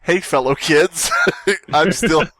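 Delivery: ordinary talk, nothing marked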